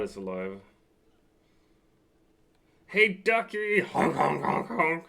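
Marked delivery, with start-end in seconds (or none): 2.9-5.0 high, loud goofy clown honks; silly clown. Pretend. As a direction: honk like a playful clown. Greets the bot with honks.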